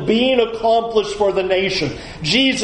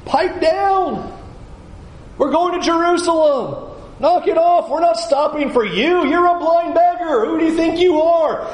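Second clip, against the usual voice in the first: high, scolding crowd voice; mock crowd scolding a blind beggar, pitched high; scornful crowd; 8 semitones higher than usual. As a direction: shoo the beggar away with contempt. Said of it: "they're looking at him going".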